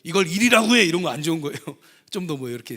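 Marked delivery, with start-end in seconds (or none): 0.0-0.8 louder, higher belittling jab; scolding boss. Retold or imitated. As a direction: snap at a junior worker. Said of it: "남성분들이 얘기할 때".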